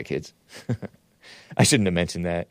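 ordinary talk, nothing marked

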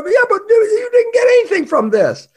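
loud, very high-pitched objection; exasperated protest. Acted. Acted protest to the brawler.

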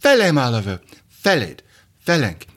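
put-on glamorous Hungarian accent; Eva Gabor Hungarian accent. Shown as a demonstration. Sitcom wife addressing her husband Oliver, mock Hungarian accent. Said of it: "I'll do Eva Gabor from Green Acres".

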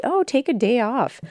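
high mocking singsong; mocking friends. Mocking friends who tell her to rest, in a high sneer. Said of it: "they say".

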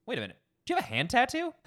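imitating the boss's sudden high, loud, fast question; spring it on him, high and quick; surprised boss. Boss spotting the new hire's hand tattoo, mock surprised. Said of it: "And then he was like".

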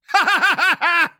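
a quick laugh at himself; short laugh. Real, not acted.

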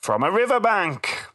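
sing-song joke delivery, slow high punchline; child reciting a joke. Retold or imitated. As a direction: recite a joke as a proud small kid would. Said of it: "he actually read it quite well".

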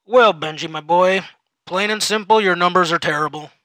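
jovial boss delivering bad news. Supervisor scolding employee.

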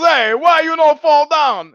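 bewildered pleading complaint; Italian soldier. Retold or imitated. Soldier begs German to fall in mock Italian accent. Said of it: "He finally says".

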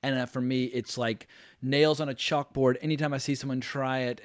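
ordinary talk, nothing marked

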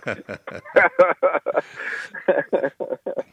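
long, real burst of laughter; amused laugh. Real, not acted. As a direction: laugh heartily at the teasing. Amused by host's mock outburst.

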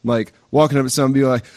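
normal talking voice

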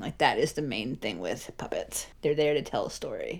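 hushed whisper. Real, not acted. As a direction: drop to a whisper. Whispered aside about storytelling.

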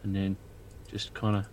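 a spooked dwarf whispering-low to his friend; low hushed dwarf Leopold. Acted. Player voicing Leopold character.

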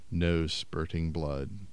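ordinary delivery, nothing marked

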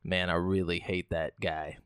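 low, soft, slow grumble as a British bystander; imitating a grumbling bystander. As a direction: mutter it low and slow like a guy filming who's annoyed. Imagined bystander filming the punch.

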